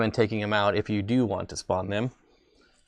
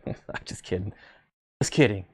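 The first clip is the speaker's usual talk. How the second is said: laughing; 3 semitones lower, 4 dB quieter than usual. Real, not acted. Joking after teasing viewers.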